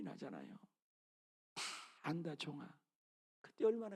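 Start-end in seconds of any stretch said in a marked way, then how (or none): 1.5-2.7 soft, tender comfort; tender voice of God. Acted. God comforting the struggling missionary, soft and tender. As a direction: hush it gently like a consoling father.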